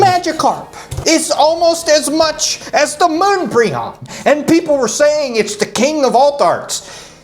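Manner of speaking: high, slow disbelief; can't believe it, voice up high; incredulous. Real, not acted. Exasperated rant about Magikarp price.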